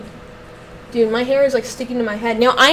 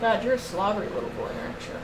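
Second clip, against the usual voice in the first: softer, tender coo to the dog; 3 semitones lower, 12 dB quieter than usual. Real, not acted. Owner apologizing to her slobbery dog, soft and doting. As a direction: make it up to him gently.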